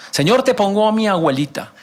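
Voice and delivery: high-pitched, hammed-up petition; mock prayer plea. Believer praying for grandmother, mock pleading.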